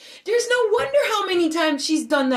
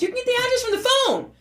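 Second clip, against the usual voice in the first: high-pitched, impatient retort; exasperated outburst; 11 semitones higher, faster than usual. Retold or imitated. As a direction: snap back at the operator, pitch soaring. Exasperated with emergency operator.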